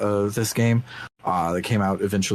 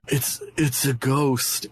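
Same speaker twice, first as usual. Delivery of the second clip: scared guard; 5 semitones higher, slower than usual. Retold or imitated. Guard found in shambles, terrified.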